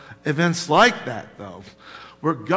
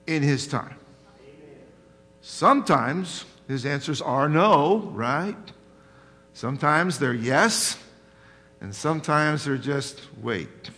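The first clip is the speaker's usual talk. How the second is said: ordinary talk, nothing marked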